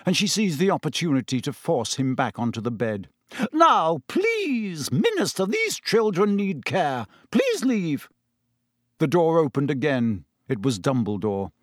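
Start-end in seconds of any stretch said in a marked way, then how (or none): 3.5-8.1 high-pitched, pleading matron; insistent matron. A narrator's reading. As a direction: shoo visitors out in a high voice. Matron ordering visitors out.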